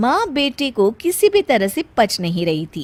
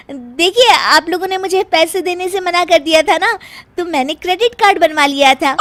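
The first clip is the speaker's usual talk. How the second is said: higher, louder quick confession; cheeky bahu; 7 semitones higher, 5 dB louder than usual. Acted. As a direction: confess proudly, rushing it. Meena explains the credit card. (सास और पति को चहककर सफ़ाई देती बहू)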